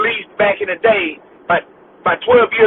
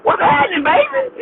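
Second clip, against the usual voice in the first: slangy greeting repeated; 10 semitones higher than usual. Retold or imitated. Repeating the greeting to a confused coworker, higher and slangier. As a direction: lay the slang on thick. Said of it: "what did you just say".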